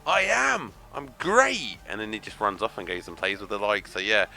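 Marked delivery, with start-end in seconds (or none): 0.1-1.8 lightly higher, goofy put-on voice for the dog; a hint of a cheerful dog agreeing; mock dog voice. Voicing Jake the dog agreeing with him, goofy and higher.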